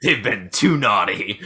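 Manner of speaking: slow, low tagline; mock trailer announcer. Host capping his Santa movie pitch, trailer announcer. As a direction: intone it as a hammed-up movie tagline.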